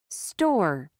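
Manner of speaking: "Store" with no puff of air after the t; "Store" with an unaspirated t. Shown as a demonstration. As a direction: keep the t after s in "Store" free of extra breath. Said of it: "we do not aspirate the sound".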